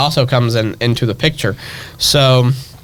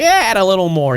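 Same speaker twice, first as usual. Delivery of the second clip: higher, offhand imitation of grandma; casual grandma voice; 7 semitones higher, faster than usual. Grandma coaching grandchild at the mixing bowl, casual higher voice. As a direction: lightly voice a grandma eyeballing a recipe.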